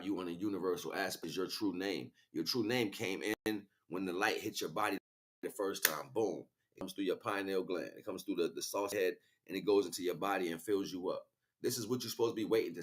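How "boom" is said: normal talking voice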